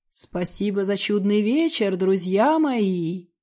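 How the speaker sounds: slow, mocking farewell; slow drawn-out farewell. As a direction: drag out the goodbye slowly. Voice carried on the wind.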